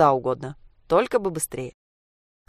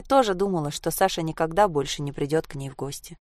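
ordinary delivery, nothing marked

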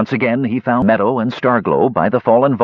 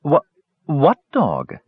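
slow, stammered puzzled question; bewildered; 4 semitones higher, slower than usual. A narrator's reading. Meadow puzzled by Gorath's talk of a dog, stammering. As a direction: stumble on it, lost. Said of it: "also bewildered".

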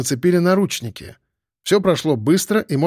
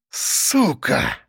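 hoarse rasp. A narrator's reading. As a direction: curse croaked out through rage. Enraged boss rasps curse again.

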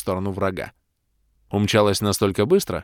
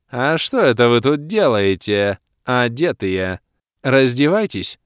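slow, teasing, higher and louder; playful demoness; 3 semitones higher, 5 dB louder, slower than usual. A narrator's reading. Demoness urging the party to strip, slow teasing voice. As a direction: tease them into undressing.

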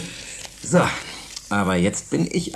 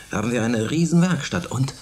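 ordinary talk

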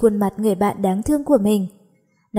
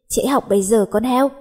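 usual speaking voice

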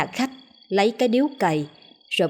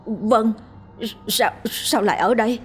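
a lightly voiced, stuttering terrified man; stammering in fright; 3 semitones higher than usual. A narrator's reading. Man seeing his dead father and sister, stammering. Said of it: "Miệng ông Tùng lắp bắp".

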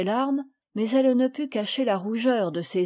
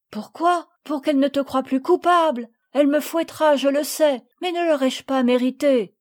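higher, louder pleading retort; impassioned protest; 4 semitones higher, 5 dB louder than usual. A narrator's reading. Sophie insists on confessing despite the whipping, impassioned protest. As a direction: insist with remorseful fervour.

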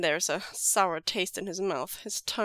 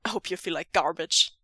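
gruff; 3 semitones lower, 4 dB louder, faster than usual. A narrator's reading. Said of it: "says gruffly".

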